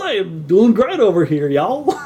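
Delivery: a light mock Southern drawl, rising high on y'all; a chipper hillbilly greeting in a Southern accent. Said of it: "hilarious, like, southern accent".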